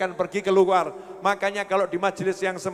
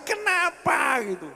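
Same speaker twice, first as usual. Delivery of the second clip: rhetorical shout; 7 semitones higher, slower than usual. Real, not acted. Preacher's rhetorical outburst.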